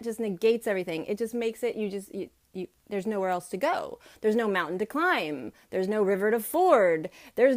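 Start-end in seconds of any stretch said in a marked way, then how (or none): none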